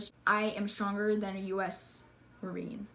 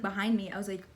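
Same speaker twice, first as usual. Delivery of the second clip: ordinary delivery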